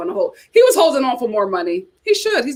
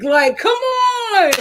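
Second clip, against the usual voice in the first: exasperated; 11 semitones higher, much slower than usual. Retold or imitated. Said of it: "And I was like".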